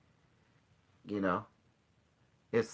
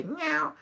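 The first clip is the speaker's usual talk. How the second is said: high mock meow; imitating his cat's meow. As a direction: meow like the cat, pitched up. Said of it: "And he say".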